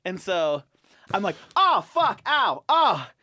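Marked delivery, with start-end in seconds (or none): 1.6-3.1 loud, high yelps of pain; faked pain. Player hit by a ball, hammed-up pain. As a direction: oversell the injury. Acting hurt to prank friend.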